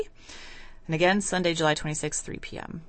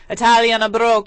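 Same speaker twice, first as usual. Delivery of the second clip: louder, hammed-up Italian accent on the phrase; fun Italian accent; 4 semitones higher, 9 dB louder, faster than usual. Host imitates the guest's Italian accent, playful Italian accent. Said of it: "Can you do your fun Italian accent".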